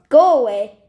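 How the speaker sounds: loud warning. Acted. Stephanie warning the man outside, loud go away. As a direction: say it loudly to scare him. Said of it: "she said loudly".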